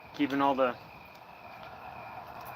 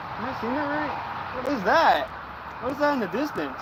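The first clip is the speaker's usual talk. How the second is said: stunned wonder; 9 semitones higher than usual. Real, not acted. Staring at the sky.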